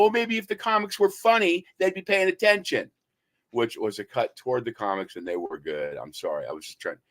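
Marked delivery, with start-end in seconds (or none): 0.0-2.9 high-pitched, heated comeback; acted-out indignant dig, pitched up; retold cutting retort. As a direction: toss back a jab at the comics.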